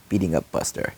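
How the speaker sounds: ordinary delivery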